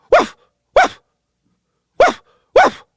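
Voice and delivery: barked woof noises, loud and short; imitated dog bark. Shown as a demonstration. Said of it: "That is the sound of a dog barking".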